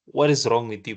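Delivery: ordinary talk, nothing marked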